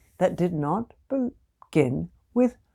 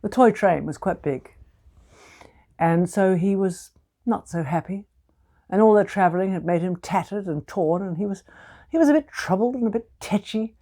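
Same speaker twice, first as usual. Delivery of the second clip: ordinary delivery, nothing marked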